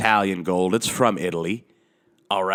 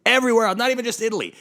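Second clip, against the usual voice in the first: much higher, louder exasperated cry; exasperated high burst; 13 semitones higher, 4 dB louder, much faster than usual. Real, not acted. Comedian ranting about gravy, exasperated high burst. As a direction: throw it up high in disbelief.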